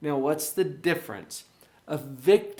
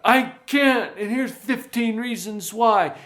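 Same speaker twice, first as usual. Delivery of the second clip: higher, louder exclaimed "I can't"; emphatic victim; 7 semitones higher, 6 dB louder than usual. Shown as a demonstration. Coach acts the victim's loud exclaimed refusal. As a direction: blurt it out like a complaint. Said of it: "usually there's an exclamation point after that".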